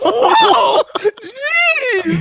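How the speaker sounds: high, loud startled squeal with a laugh; startled shriek. Real, not acted. Cohost startled by a rude joke, shrieks high. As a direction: jump out of your skin, squealing high. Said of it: "that was aggressive".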